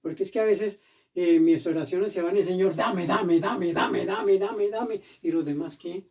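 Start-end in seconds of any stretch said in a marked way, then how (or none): 2.4-5.0 mimicked begging prayer. Preacher mimics selfish prayers to the Lord, mock begging. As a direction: imitate a greedy, insistent prayer.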